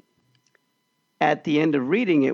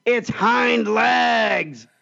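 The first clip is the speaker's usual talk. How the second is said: very slow, higher mock angry teacher; 7 semitones higher, much slower than usual. Teacher hitting the boy with the workbook, in a very slow, higher mock angry voice. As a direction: spell it out word by word as she strikes.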